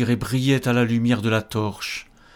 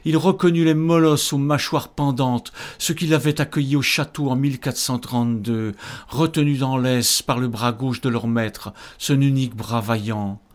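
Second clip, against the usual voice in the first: usual speaking voice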